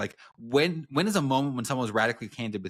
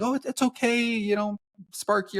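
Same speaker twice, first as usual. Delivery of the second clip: high, sweet coaxing voice, much higher; cooing at a puppy she can't discipline; soft doting owner; 13 semitones higher, 3 dB louder than usual. Retold or imitated. Author gently soothing her tugging puppy, high sweet coo. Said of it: "very soft with it".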